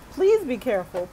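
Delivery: calling out a friend's safety warning; urgent plea. Real, not acted. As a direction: push the warning out, earnest and loud. Urging friend near Houston floods.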